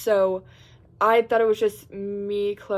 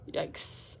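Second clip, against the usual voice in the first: whispered yikes; 11 dB quieter than usual. Real, not acted. Realising the time.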